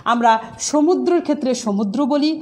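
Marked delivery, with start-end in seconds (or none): 1.5-2.0 সমুদ্র with rounded o, "sho-mudro"; Bangladeshi closed o. Shown as a demonstration. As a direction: say সমুদ্র with first vowel closed to o. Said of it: "একটা ওকার দিয়েছি".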